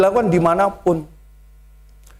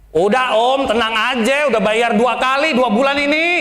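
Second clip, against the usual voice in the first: a touch higher, blunt Betawi comeback; Betawi banter; 6 semitones higher than usual. Retold or imitated. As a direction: toss it back, cheeky and blunt. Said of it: "gaya Betawi yang begitu nembak-nembak". (jawaban Betawi yang blak-blakan)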